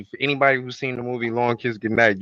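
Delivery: usual speaking voice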